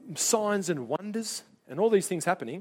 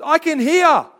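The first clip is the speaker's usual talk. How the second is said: high, loud cry of amazement; astonished shout; 11 semitones higher, 13 dB louder, slower than usual. Retold or imitated. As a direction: shout it out as if suddenly healed. Retold healed deaf man exclaiming.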